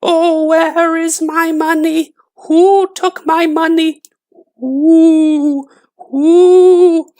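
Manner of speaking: very high, slow, eerie ghostly wail; ghost crying. A narrator's reading. Dead woman's ghost calling for her money, high eerie wail. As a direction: moan like a far-off ghost hunting her money. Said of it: "the ghost of the dead woman cried".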